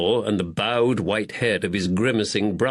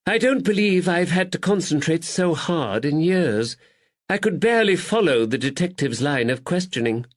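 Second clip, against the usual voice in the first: high, theatrical sighing lady; weary lady; 7 semitones higher than usual. Acted. Lady Caroline tells her lover she is exhausted, weary. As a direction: sigh it like a pampered society lady.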